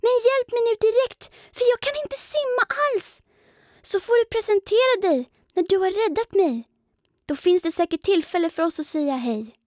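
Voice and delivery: high, hurried small-spider voice pleading; frantic little spider. A narrator's reading. Pindel urges the flying creature to rescue him, high hurried plea. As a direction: beg fast, half panicked, half cheeky.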